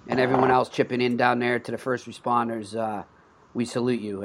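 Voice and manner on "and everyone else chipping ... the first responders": usual speaking voice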